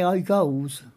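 "I goes" in a light West Country accent; east Wiltshire dialect. Shown as a demonstration. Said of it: "they say".

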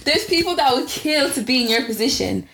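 mock impression of friend scolding. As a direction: imitate your friend, lecturing him. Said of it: "the voice that you do for me".

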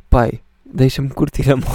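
loud, playful shout at dad; retold loud retort. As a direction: bark it back at him, half joking. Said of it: "E eu disse".

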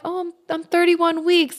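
high-pitched retold answer; imitating her own chirpy mid-race reply; cheery reply. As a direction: toss it off brightly in a high pitch.

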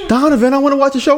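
high, quick mocking voice; rapid high-pitched mock complaint; mimicked complaining girlfriend. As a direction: imitate a girlfriend pestering you.

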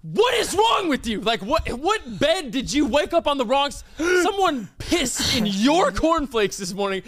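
high, shouted indignant rant; hammed-up furious telling-off; outraged tirade. Retold angry tirade at manager.

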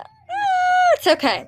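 high cracking cry, then okay; voice breaking. Real, not acted. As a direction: let the voice crack. Breaking up over mania.

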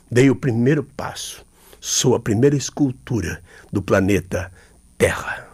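slow, measured spoken-word delivery with weighty pauses; poem recitation. Acted. Poet performing his own poem on stage, slow solemn declamation. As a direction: let each image land like a ritual chant.

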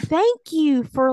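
high, loud, slowed gratitude; gushing thanks. Retold or imitated. Thanking the understanding lady, gushing. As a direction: pour on the thanks, stretched out.

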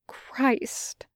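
breathless whispered oath; whispered curse. Acted. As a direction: mutter it under your breath. Despairing curse under breath.

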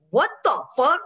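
retold disbelief. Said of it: "there's part of me that's like".

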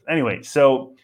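ordinary talk

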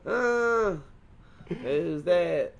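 high, loud twangy calling out; country twang. Acted. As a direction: call out like a puzzled country local. Acted twangy country local, startled.

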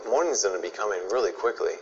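ordinary talk, nothing marked

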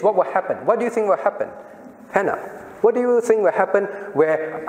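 ordinary delivery

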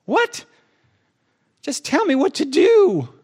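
high, loud "What?" then demanding "tell me what to do"; a frustrated plea that cracks upward; exasperated whine. Retold or imitated. Pastor mimicking himself begging God for orders, exasperated whine.

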